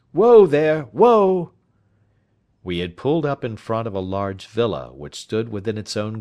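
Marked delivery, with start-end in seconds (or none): 0.1-1.5 loud, high driver's call; calling to horse. Acted. Driver reining in at the villa, loud high call. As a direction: shout it out to halt a horse.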